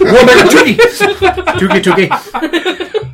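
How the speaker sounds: high, giddy chant through laughter; squealing with laughter; laughing. Real, not acted. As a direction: crack up while repeating the nickname. Cracking up over a silly nickname.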